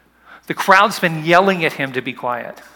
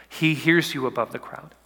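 normal talking voice

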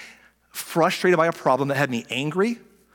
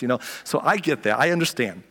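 ordinary delivery

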